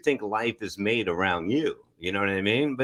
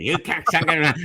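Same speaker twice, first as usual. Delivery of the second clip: Joe Pesci impression; 6 semitones higher, 3 dB louder than usual. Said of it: "Let's do Joe Pesci".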